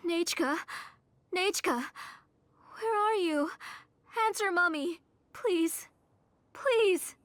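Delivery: put-on rising, pleading calls for a lost child; desperate calling. Mother calling her vanished daughter, pleading and frantic.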